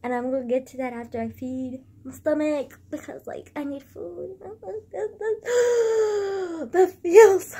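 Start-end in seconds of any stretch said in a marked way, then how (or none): 4.4-6.7 high breathless sob-gasp; real emotional crying gasp. Real, not acted. Overcome with feelings. 6.7-7.6 much louder, wailed through tears; real overwhelmed crying; crying happy tears. Real, not acted. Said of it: "Just having a moment right now".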